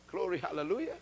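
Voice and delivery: low, soft glory hallelujah; soft murmured praise. Real, not acted. Preacher murmuring praise to himself, soft. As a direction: breathe it out under the voice.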